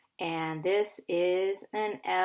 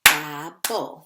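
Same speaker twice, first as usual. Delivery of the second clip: "Gobble" in two beats, louder, gap after "Gob"; split into syllables; 3 semitones lower, 8 dB louder than usual. Shown as a demonstration. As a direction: punch out "Gobble" as gob, bull. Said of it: "we're gonna do two syllables".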